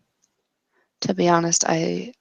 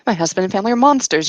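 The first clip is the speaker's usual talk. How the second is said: fast, louder rush of words; hurried, raised confession; blurted out; 3 semitones higher, 4 dB louder, much faster than usual. Acted. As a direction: blurt it before losing nerve.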